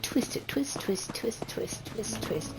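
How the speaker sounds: fading whisper. Acted. Describing mustache twisting, fading.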